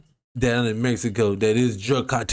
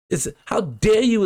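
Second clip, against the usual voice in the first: high, louder, indignant challenge; impassioned outrage; 9 semitones higher, 3 dB louder than usual. Real, not acted. Joining the auntie's rant, echoing her indignant cry. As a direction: throw her outrage back at the party. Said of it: "I'm gonna have to say it with her".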